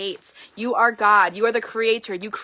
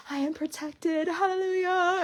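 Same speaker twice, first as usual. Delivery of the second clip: relieved praise; 7 semitones higher, 5 dB quieter, slower than usual. Retold or imitated. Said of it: "And I thought".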